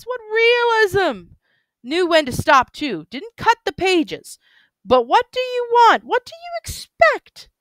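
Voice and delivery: put-on gushing drunk man. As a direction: marvel drunkenly at every detail. Drunk man raving about library.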